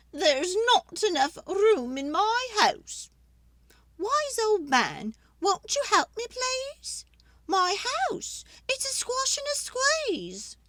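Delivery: put-on fretful old lady. Old lady begging the wise man for help, high and pleading.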